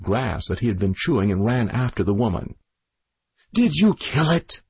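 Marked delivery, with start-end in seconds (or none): 3.5-4.6 screamed. A narrator's reading. The widow screams at Packy from the hill. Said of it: "screamed the widow".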